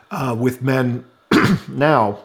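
throat catching, choking slightly; choking a little. Real, not acted. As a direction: struggle through a tickle in the throat. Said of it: "I was choking there a little bit".

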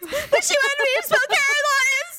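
put-on high, whiny crying child; mock sobbing kid. Kid explaining the spelling bee to mom, high whiny sob. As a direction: wail it like a heartbroken second grader.

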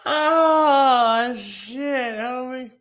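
high, breaking laughter that spills into the words; cracking up. Real, not acted. Cracking up at his own jab at the coach, high breaking laughter.

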